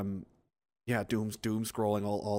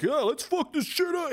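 much higher, whooping gung-ho voice; mock hyped rioter. Mocking eager rioters, high whooping hype. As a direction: ham up a reckless hothead. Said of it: "guys out there being like".

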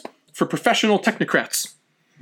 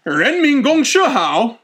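slow, higher-pitched drawn-out pronouncement; Mao's proclamation; 5 semitones higher, slower than usual. Acted. Mao visiting a commune, slow lofty proclamation. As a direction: proclaim it slowly like a visiting leader. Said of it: "stops by and he goes".

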